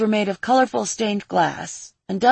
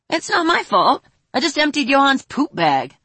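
put-on whiny boy voice; 7 semitones higher, 4 dB louder than usual. Boy protesting, lowered head.

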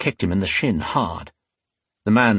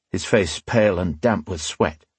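usual speaking voice

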